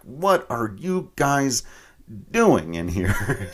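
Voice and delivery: slow, high, drawn-out surprised question. Retold or imitated. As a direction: act baffled at finding them. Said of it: "I'm like".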